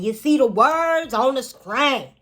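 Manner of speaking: high, loud, slow twang; exaggerated twang. Shown as a demonstration. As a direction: stretch the twang out big and loud. Twang repeated bigger.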